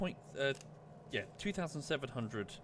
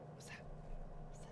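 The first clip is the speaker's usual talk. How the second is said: whispered pet talk; 14 dB quieter than usual. Real, not acted. Asking the dog.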